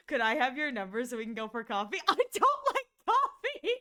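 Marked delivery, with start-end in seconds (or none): 2.1-3.7 higher, louder, laughing through it; can't hold the straight face; laughing attempt at the deadpan reply; laughing quote. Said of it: "Kaede's quiet deadpan".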